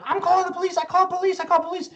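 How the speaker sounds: high, insistent broken English of a foreign club owner; imitating club owner's accent. Mock accented club owner threatening to call police. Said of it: "the owner of the club is like".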